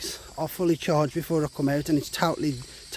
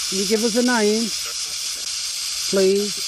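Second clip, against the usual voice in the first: loud, high call into the woods; calling out to spirits; 5 semitones higher, 4 dB louder than usual. Acted. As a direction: call out to any ghosts nearby.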